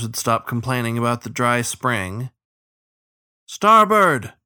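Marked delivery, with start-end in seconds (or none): 3.5-4.3 high, loud warning call; shouted warning. A narrator's reading. Rower relaying the warning toward the tiller, shouted. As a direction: call it out down the boat. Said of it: "Shen repeated the warning".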